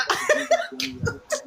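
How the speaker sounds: soft airy laugh with no voice; breathy laugh. Real, not acted. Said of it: "Don't laugh again".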